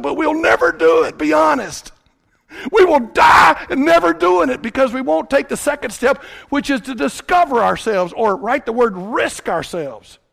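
loud, high revival preaching; fiery sermon delivery; preaching at full force. Acted. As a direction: build to a pulpit-pounding call.

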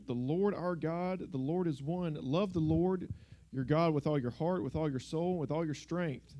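ordinary delivery

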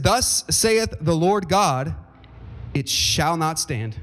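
slow, weighty prophetic reading; solemn proclamation. Acted. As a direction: let the words land one by one.

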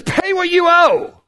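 high, pushy voice of the creditor; demanding servant. Acted. Servant to his debtor, a high demanding voice. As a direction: throw the demand up high like a greedy man.

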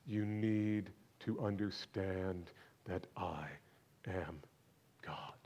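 slow, fading to near whisper; hushed solemn. Acted. Preacher quietly declaring Jesus' divinity, slow and hushed. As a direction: let the words sink to a hush.

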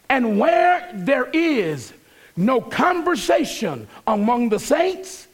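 pastor preaching to his congregation, building fervor; full preaching. Acted. Preaching a letter to the church.

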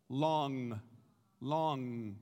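long with the back of the tongue on the roof of the mouth, ending in a clear ng; saying long. Shown as a demonstration. Pastor demonstrating long for the class, ng held at the back. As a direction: say long slowly, landing the final ng. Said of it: "I want you to say long out loud".

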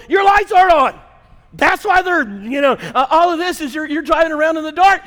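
high, loud roadside yell; imitated yelling wife. Wife yells to driver from in front of car, imitated yelling. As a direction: yell it like an exasperated wife at passing cars. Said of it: "So she's yelling at me".

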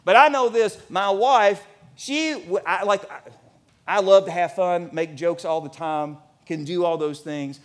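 ordinary delivery

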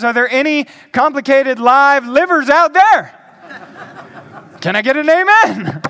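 rousing preacher's call, high and loud; rally the room for a response. Real, not acted. Preacher asking his congregation for an amen, calling out.

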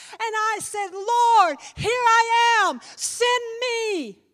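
high, very loud, drawn-out cry; full-force preaching climax. Acted. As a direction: shout the answer up to the Lord. Preaching climax, answers the call.

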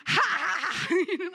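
loud joyful laughter; laughing. Shown as a demonstration. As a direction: laugh out with gladness. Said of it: "I just found it hilarious".

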